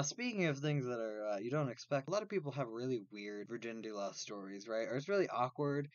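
normal talking voice

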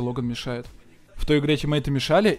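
ordinary talk

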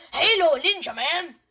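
loud, punchy name shout; loud call-out. Acted. Calls out against a player.

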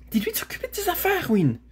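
high, rapid command; cross interruption. Acted. Girl interrupting her mare, high and cross. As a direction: cut in fast and sharply. Girl cuts into horses' talk.

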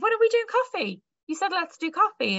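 high, incredulous quoted question; retold puzzled insistence. Newcomer pressing a British colleague on when coffee is, high and incredulous. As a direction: pitch it up high, genuinely baffled why there's no date yet.

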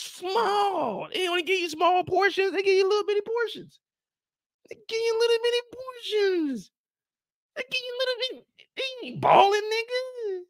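mock the tiny portions; high incredulous scoff; mock whine. Mocking the small portions.

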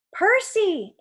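high, loud cry of a name; indignant yell. A narrator's reading. Annabeth calling her friend from the bed, yelling. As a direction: yell at your friend to get his attention. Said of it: "Annabeth yelled".

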